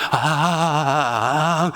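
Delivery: low drawn-out 아 moan; imitated prayer moan. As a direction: groan it like someone praying in pain. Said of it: "이러면서 기도를 하세요".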